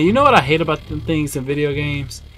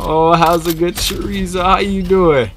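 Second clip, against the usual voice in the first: high, loud playful hello; cheerful high greeting to a pet; silly greeting voice; 7 semitones higher, 6 dB louder than usual. Acted.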